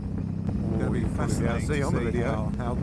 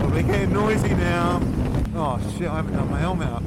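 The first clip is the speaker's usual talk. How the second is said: shouting over wind; 6 semitones higher, 7 dB louder than usual. Real, not acted. Wind noise on motorway.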